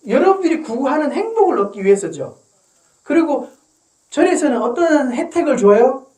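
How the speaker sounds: usual speaking voice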